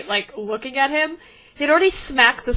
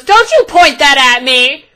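furious yell at armed guards; angry shout; 4 semitones higher, 10 dB louder than usual. Acted. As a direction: bark it at the guards, loud and high. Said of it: "smack the sword out of one of their hands and goes".

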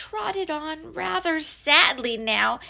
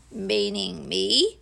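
slow, low question rising at the end; low doleful donkey; disbelieving gloomy Eeyore; 4 semitones lower, slower than usual. Acted. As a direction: drag it out doubtfully.

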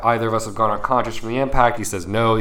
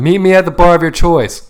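higher, louder call to someone far off; raised storytelling shout; acted-out yell; 8 semitones higher, 6 dB louder than usual. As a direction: yell it across the water. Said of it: "yell at him".

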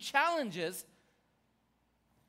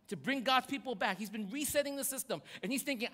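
ordinary delivery

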